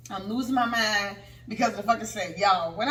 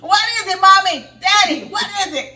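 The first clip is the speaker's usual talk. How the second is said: high, loud child imitation; excited little son; 9 semitones higher, 11 dB louder than usual. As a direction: pipe up loudly like a curious kid. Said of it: "So my son like".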